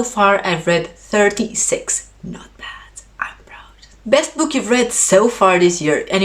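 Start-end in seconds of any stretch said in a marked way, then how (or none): 2.2-3.9 soft proud whisper under the breath; hushed little self-congratulation; whispered aside. Real, not acted. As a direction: whisper it to yourself, pleased. Quiet aside to camera.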